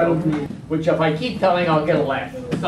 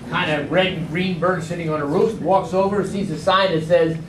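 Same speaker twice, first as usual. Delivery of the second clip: ordinary talk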